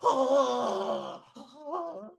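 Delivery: high loud wailing scream; mock terrified cry. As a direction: wail on the drop. Rider crying out.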